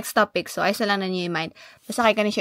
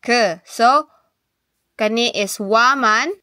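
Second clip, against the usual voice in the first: ordinary delivery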